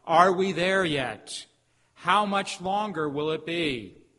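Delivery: loud, slow, drawn-out cry; impatient plea to God. Retold or imitated. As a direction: call out to heaven, stretching every word. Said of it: "we can find ourselves asking God".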